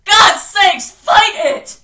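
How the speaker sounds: loud, high, exasperated yell; urgent shout. Acted. Richie urging Bill to fight, shouting hard. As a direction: yell at him to act. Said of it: "Richie shouted".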